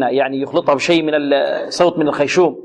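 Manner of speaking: usual speaking voice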